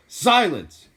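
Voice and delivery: loud, high bellow; yell. A narrator's reading. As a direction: yell it to shake the ceiling. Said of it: "yelled Uncle Vernon".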